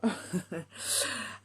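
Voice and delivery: quiet, voiceless chuckle; soft laugh. Real, not acted. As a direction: laugh softly at yourself. Laughs after joking about herself. (petit rire étouffé)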